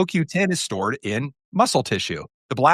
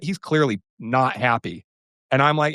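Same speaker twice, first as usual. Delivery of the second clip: ordinary talk, nothing marked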